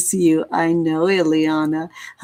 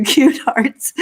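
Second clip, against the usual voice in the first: laughing through the words, a bit higher; 6 semitones higher, 3 dB louder than usual. Real, not acted. Touched by Dahlia's hearts, laughing. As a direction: giggle as you read it. Reacts to a chat message.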